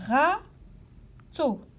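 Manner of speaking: "râteau" in two separate beats, pause between them; syllable by syllable. Shown as a demonstration. Teacher asking where ra is heard, "râteau" syllable by syllable. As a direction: stretch "râteau" apart at the syllable break. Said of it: "À quel endroit entend-on la syllabe".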